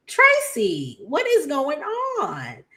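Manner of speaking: what on earth, Tracy; high disbelief; incredulous. Real, not acted. Calling out Tracy's behavior.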